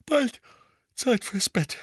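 hoch und laut in ein Gähnen gesprochen; gähnend. A narrator's reading. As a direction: die Worte mit einem Gähnen herausdehnen. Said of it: "sagte Misses Weasley gähnend". (Mutter gähnt, Zeit fürs Bett, gähnend)

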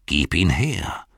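slow, gripping demand; possessed, reaching for the ring. Acted. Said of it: "schrie er".

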